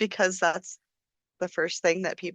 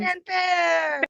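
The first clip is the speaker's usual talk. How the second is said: mock fanfare; 7 semitones higher than usual. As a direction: announce it like a trumpet. Mock celebration, shouted.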